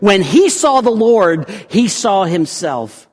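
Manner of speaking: loud, high proclamation easing at the end; ringing pulpit declaration; full-force preaching. Real, not acted. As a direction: drive the point home to the congregation.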